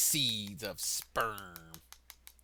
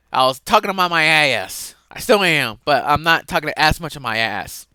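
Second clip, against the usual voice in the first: high, shouted confession; loud self-mockery; 4 semitones higher, 15 dB louder than usual. Speaker admitting they talked nonsense, loud high self-mocking rant. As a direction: own up at full volume.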